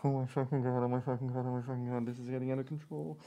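hushed, frantic muttering under her breath; mock panic. Hillary panicking to herself over the murders, hushed muttering. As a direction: panic quietly to yourself, spiraling. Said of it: "she went".

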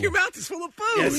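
high playful yell; teasing shout. Real, not acted. Teasing shout over call.

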